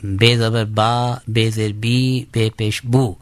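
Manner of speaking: بے زبر با، بے زیر بی، بے پیش بو said slowly, each vowel sound drawn out; slow teacher's drill, sounding out با، بی، بو; زیر زبر پیش کی مشق، آہستہ; spelling drill. Shown as a demonstration. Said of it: "تو جیسے".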